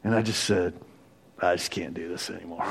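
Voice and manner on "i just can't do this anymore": much softer, a bit faster, defeated; broken admission. Retold or imitated. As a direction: let it fall out of you, spent. Retold breaking point confession.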